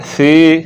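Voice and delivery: "सी" with the vowel held long; over-lengthened "सी"; drawn out. Shown as a demonstration. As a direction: stretch "सी" out slowly. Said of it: "लंबा नहीं करना".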